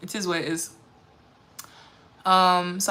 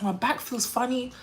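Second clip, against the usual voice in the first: ordinary talk, nothing marked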